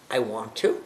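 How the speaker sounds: I want to said low and soft, trailing away; low, soft take. Shown as a demonstration. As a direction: let I want to sink low and quiet. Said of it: "I have to".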